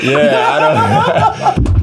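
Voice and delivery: slow, breaking into laughter mid-line; laughing. Real, not acted. Player laughs about not understanding Scouse teammates, laughing. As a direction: let the laugh take over the words.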